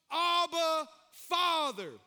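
loud, ringing call to the Father; loud heartfelt cry of Abba Father. Acted. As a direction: lift the voice and cry it out. Reading the cry in the verse.

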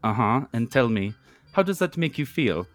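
slow mock German therapist accent; put-on German analyst accent. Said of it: "says with a German accent".

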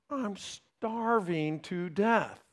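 put-on very slow, drawn-out, soft child's complaint; complaining child. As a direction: drag it out like an overdramatic hungry child. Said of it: "had a child that would say something like this".

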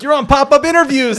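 high, loud, eager announcement; bright excited host. Retold or imitated. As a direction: sing it out like a cheerful host. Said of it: "you could hear the brightness in your voice".

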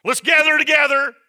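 high, forceful pulpit delivery; fired-up sermon voice; impassioned preaching. Real, not acted. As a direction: preach it hard with emphatic, punched phrases.

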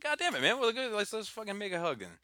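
high-pitched mock-exasperated delight; playful, high burst of affection; touched outburst. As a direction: jump up high, giving in to a hug. Acts out being moved to hug.

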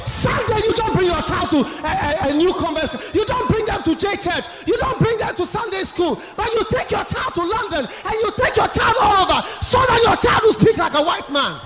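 high-pitched, driving scolding; heated rebuke. Acted. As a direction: scold the parents in a rising tirade. Preacher rebuking parents over their priorities.

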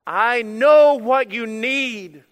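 slow, loud, drawn-out proclamation; booming declaration. Acted. Preacher voicing the shepherd to his sheep, slow and booming. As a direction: boom it out like the shepherd calling his flock.

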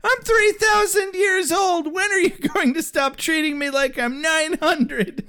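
high-pitched mock teen tantrum; hammed-up squeaky complaint; whiny defiant daughter. Daughter protesting her father's treatment, high and whiny.

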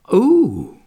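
high, loud ooh of wonder; rapturous. Acted. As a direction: coo loudly in sheer delight. Wendy thrilled by promise of flying.

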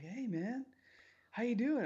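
high, loud buddy greeting; mock greeting. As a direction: hail them like old pals. Retelling friend greeting nerds.

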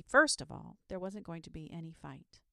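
ordinary delivery

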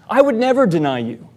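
brash, emphatic denial; brash self-confidence. Retold or imitated. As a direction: fire it out with brash certainty. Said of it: "Peter's brash self-confidence".

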